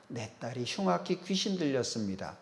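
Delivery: a quiet, broken-down mother's plea; hushed plea. Acted. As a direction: beg softly, almost under the breath. 어머니가 절하며 간청함. (낮고 조용한 간청)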